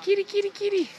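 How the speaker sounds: very high sing-song cat call; calling kitty. Real, not acted. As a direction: coo up high to lure the cat. Calling stray cats.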